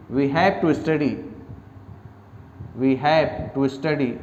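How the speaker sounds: very slow, each word spaced; slow dictation pace. Shown as a demonstration. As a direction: dictate it for note-taking. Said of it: "लिखिए".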